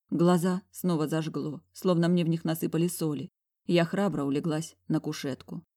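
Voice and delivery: ordinary delivery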